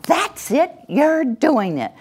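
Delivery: high, bright praise as she moves; encouraging praise. Shown as a demonstration. Parent praising the child the moment she moves. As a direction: cheer her on the moment she starts. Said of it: "you're gonna say".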